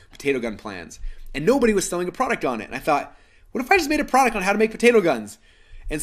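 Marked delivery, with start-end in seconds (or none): 3.5-5.4 eureka moment. Retold or imitated. Retelling a sudden business idea.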